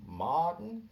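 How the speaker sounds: slow, rising stretched Martin; drawn-out Martin. Acted. Comic stretching Martin for the crowd. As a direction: draw Martin out slowly with a rise.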